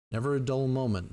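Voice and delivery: ordinary delivery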